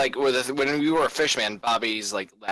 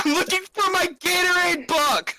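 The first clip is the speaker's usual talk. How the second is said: frantic shout. Acted. Frantic yell, high and far.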